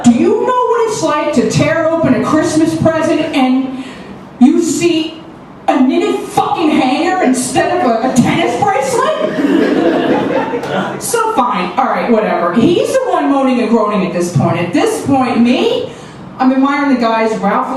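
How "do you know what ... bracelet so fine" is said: ordinary talk, nothing marked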